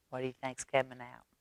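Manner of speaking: plain reading voice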